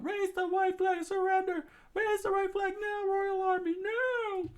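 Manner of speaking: high, shouted demand; screaming princess. Acted. Princess screaming at the army to surrender. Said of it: "screamed out".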